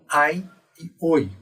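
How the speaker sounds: ai and oi said as full diphthongs; restored diphthongs. Shown as a demonstration. Teacher models restored Latin diphthongs. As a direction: open ai and oi, not é. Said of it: "vão ser pronunciados como".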